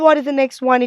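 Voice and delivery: ordinary delivery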